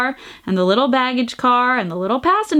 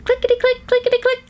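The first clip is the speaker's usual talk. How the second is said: high, tiny clickety-click; squeaky toy-train chant; little train voice; 12 semitones higher, faster than usual. A narrator's reading. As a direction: make it sound like a tiny train.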